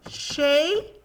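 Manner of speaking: CHEIRO split as chei, ro with a gap; lingering CHEI, then a separate RO; syllable by syllable. Shown as a demonstration. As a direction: count out "cheiro" in two beats. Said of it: "tem duas sílabas".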